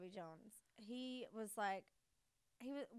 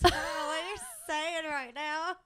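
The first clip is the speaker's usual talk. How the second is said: louder, higher husband voice, teasing; mock husband; 5 semitones higher, 11 dB louder than usual. Husband on the phone, baffled by her Southern talk, loud. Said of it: "he's like".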